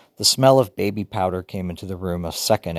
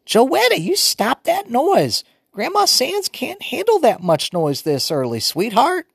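put-on high, loud old woman fussing; fussy grandmother scolding; old grandma voice; 4 dB louder than usual. A narrator's reading. Grandmother woken early, fussing at the noisy children.

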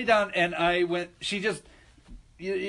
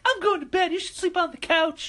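much higher voice for the sister; sober sister; 12 semitones higher than usual. Retold or imitated. Sober sister telling her drunk brother to take the couch, high and fed up. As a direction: play the exasperated sober sister. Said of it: "She's like".

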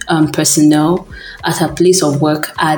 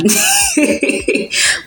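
laugh; 3 dB quieter than usual. Real, not acted. Laughs at being only human.

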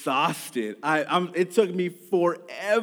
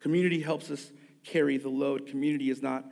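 softer, sincere closing appeal; soft, earnest pastoral voice; quiet sermon; 5 dB quieter than usual. Acted. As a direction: speak gently to those who are struggling. Quiet reflective sermon close.

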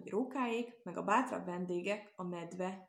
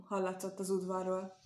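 usual speaking voice

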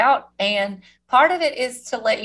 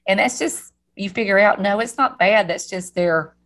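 ordinary delivery

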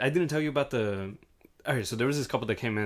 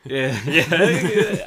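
louder, higher, laughing through it; real laugh at the joke; laughing yeah; 4 semitones higher, 9 dB louder than usual. Real, not acted. Laughing at friend's Best Buy joke.

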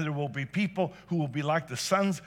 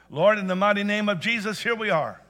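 loud, higher preaching prayer; preacher leading the church in loud prayer; fervent prayer; 6 semitones higher, 7 dB louder than usual. Real, not acted. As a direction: lift the room into prayer with full force. Leads congregation into prayer.

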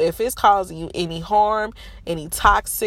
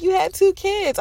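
much higher, flip nurse voice; mocking nurse; 5 dB louder than usual. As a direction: play the nurse as dismissive. Nurses reply.

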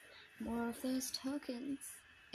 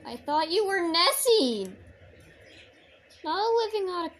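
indignant shout; 5 semitones higher, 9 dB louder than usual. Real, not acted. Arguing with game character.

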